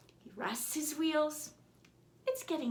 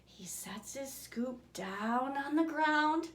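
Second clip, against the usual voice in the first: ordinary delivery, nothing marked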